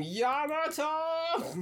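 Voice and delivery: high, long drawn-out mock scream; imitated scream. Imitating his scream as he is rotated, high drawn-out cry. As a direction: stretch the name out in a high cry. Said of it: "you hear him scream".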